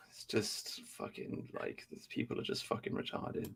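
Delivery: hushed, breathless whisper under the breath; a near-silent contemptuous whisper; whispered aside. Real, not acted. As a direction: drop to a whisper to swear.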